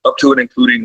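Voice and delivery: ordinary talk, nothing marked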